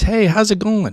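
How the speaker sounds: bright, high hello to the room; cheery greeting. Retold or imitated. Owner walking in greets staff, big cheerful hello. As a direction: greet everyone like the show's starting.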